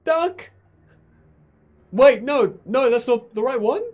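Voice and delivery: high panicked yell. Acted. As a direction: shout it in a fluster. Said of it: "Urt just yells".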